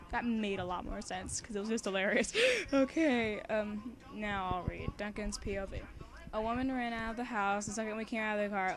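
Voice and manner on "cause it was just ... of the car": plain reading voice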